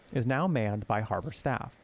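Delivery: ordinary talk, nothing marked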